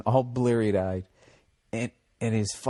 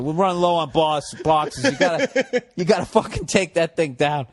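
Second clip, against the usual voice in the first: boss quote laughing; 4 semitones higher, 4 dB louder than usual. Retold or imitated. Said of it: "the boss was just like".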